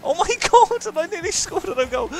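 very high, squealing excitement; high-pitched, giddy outburst; excited disbelief. Real, not acted. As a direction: squeal it, overwhelmed by the near goal. Thrilled after near goal.

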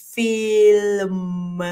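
"ฟิ ล ม" drawn out very slowly, sound by sound; super-slow "fiii-lll-mmm"; stretched like slack tape. Shown as a demonstration. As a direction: drag "ฟิ ล ม" like a sagging tape. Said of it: "ดึงออกเหมือนเทปมันยาน".

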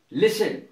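लिसन with only S before the N; silent T. Shown as a demonstration. Teacher repeating listen after spelling it, लिसन with silent T. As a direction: say लिसन leaving T unsounded. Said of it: "तो T silent रहता है".

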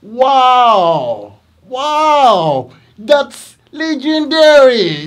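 teacher's loud, over-the-top praise for students; excited praise. Retold or imitated. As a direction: gush loudly like you are amazed. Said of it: "I say".

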